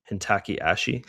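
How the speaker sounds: Hintaki Ashi said as hin-TACKY ASH-ee. Retold or imitated. Trader recalling an inside joke, imitating foreigners' mispronunciation.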